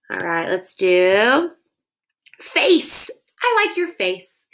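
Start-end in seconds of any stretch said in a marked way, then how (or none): 2.4-4.4 playful. Acted. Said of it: "playful aside to kids".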